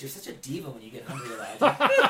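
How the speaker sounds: mock-divine teasing that breaks into laughter; laughing God voice. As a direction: tease him like God cracking up at his own joke. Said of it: "So he was like".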